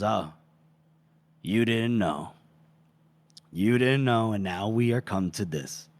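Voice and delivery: low, halting, subdued; sober realization. Acted. Duke realizing she never knew, low and subdued. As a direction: let it sink in with long pauses.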